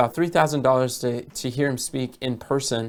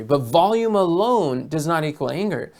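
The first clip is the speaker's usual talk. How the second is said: usual speaking voice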